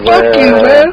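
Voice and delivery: high-pitched mock-angry retort; retold indignant comeback. Retelling his comeback to the skinny heckler, mock indignation.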